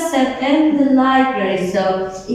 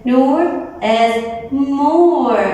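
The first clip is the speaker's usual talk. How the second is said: slow emphasis; 3 semitones higher, much slower than usual. Shown as a demonstration. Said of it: "Why did I say more beautiful?".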